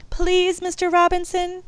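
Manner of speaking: put-on high, loud begging kids; children begging Mister Robinson; pleading. A narrator's reading. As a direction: plead with him like excited children. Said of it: "Candy and Willie pleaded together".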